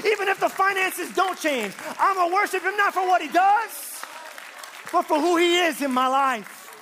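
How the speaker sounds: high, loud building sermon climax; preaching at full force. Acted. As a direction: drive it home to the congregation.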